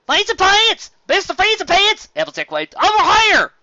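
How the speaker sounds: very high, loud shout across crowd; calling out. A narrator's reading. Applejack hailing Fancy Pants over the crowd, shouting. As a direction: yell to get his attention.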